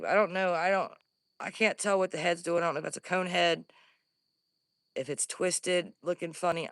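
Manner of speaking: normal talking voice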